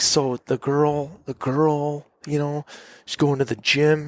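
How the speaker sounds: exaggerated, drawn-out campfire retelling; acting out the scene. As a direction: act the story out simply for foreign listeners. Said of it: "I'd have to act out the scene".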